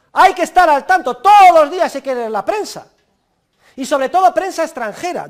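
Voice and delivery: high, forceful lecturing shout; loud exhortation. Real, not acted. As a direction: drive the order home to the students. Exhorts students after scolding them.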